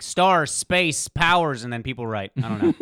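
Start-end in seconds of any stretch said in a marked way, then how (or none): 0.0-1.6 loud, lofty author voice; grand author. Retold or imitated. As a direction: declare big words like a lazy genius. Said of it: "He just kinda says stuff like".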